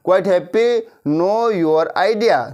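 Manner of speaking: slow, spaced, a light laugh; teacher drill with a laugh; slow drill with a chuckle. Shown as a demonstration. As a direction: repeat it slowly, amused. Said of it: "मतलब क्या कह रहा है वो कि quite happy".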